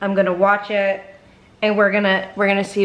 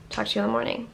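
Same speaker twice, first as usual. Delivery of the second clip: whispered sign-off; 8 dB quieter, much faster than usual. Real, not acted. Whispering goodnight so the little boy can sleep. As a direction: whisper softly, someone sleeping nearby.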